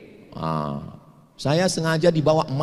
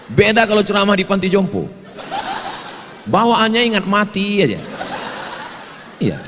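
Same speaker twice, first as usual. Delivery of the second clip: laughing; 8 semitones higher, 3 dB louder than usual. Real, not acted. Joking he feels young on campus.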